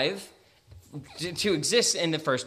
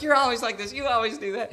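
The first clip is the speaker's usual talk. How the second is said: mock spouse fight; 7 semitones higher, 3 dB louder, faster than usual. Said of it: "bad fight with your spouse".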